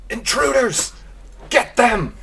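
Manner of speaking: loud high shout of alarm; shouting guards. Acted. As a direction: yell it as a guard raising the alarm.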